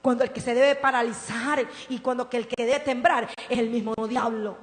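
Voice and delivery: ordinary delivery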